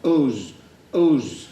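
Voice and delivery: loud, high, falling "us" with short Yorkshire vowel; own Yorkshire voice. Acted. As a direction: hammer each "us" proudly in Yorkshire. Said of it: "used my name and own voice".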